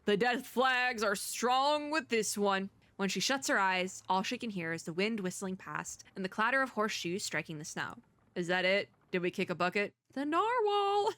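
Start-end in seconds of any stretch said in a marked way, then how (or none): 0.1-2.7 slow, drawn-out ominous line. Real, not acted. Repeats ominous remark. 10.1-11.1 high excited exclamation. Real, not acted.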